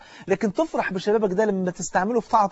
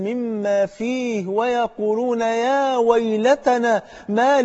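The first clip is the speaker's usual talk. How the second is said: slow recitation swelling high and loud; Quran recitation; 3 semitones higher, 4 dB louder, slower than usual. Acted. Preacher reciting the verse of the Book, swelling recitation. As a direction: recite slowly, building in pitch and force.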